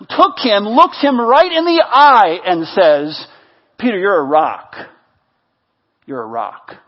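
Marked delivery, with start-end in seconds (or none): 3.8-6.8 low voice, sinking very soft; tender affirmation. Acted. Jesus looking Peter right in the eye, low and tender. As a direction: speak it quietly with long, weighty pauses.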